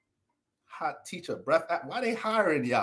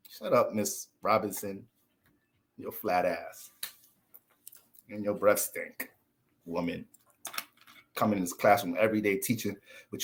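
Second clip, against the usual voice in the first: muttered comeback; 7 semitones lower, 6 dB quieter than usual. Retold or imitated. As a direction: grumble it under your breath. Retorting to teacher.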